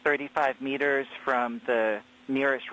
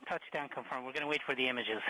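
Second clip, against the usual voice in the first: ordinary talk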